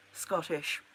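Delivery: usual speaking voice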